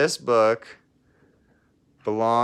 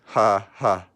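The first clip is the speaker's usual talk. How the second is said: forced joke laugh; 4 dB louder than usual. Acted. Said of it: "tried to cheer himself up with a joke".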